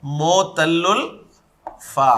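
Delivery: मोतल्लुल फा drawn out slowly, each syllable clear; slow, spelled-out term. Shown as a demonstration. Teacher repeating the term slowly for the class. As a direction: say मोतल्लुल फा slowly so students catch it. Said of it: "ठीक है, इसका नाम बन गया".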